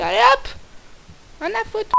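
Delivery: defiant. Real, not acted. Defiant dash.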